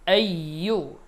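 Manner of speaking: আইয়ু with a thick, heavy ইয়া; deep ya. Shown as a demonstration. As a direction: give আইয়ু a fuller, darker ইয়া. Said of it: "ইয়ার উচ্চারণটা একটু গাঢ় হয়েছে". (আইয়ু গাঢ় ইয়া দিয়ে)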